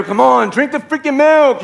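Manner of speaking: high, drawn-out frustrated plea; exasperated outburst. Acted. Husband fed up over milk, exasperated outburst. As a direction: push it out high and slow.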